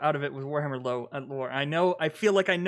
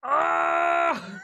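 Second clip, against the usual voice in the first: ecstatic shouting; 11 semitones higher, slower than usual. Real, not acted. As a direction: scream with delight at the trailer. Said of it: "I am so fucking pumped!".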